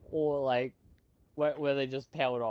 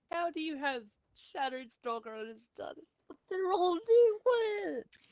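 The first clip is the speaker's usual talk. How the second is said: shocked disbelief; 14 semitones higher than usual. Real, not acted. A friend reacting to the fanfic's new words, high disbelieving squeal. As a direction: voice leaping much higher in disbelief.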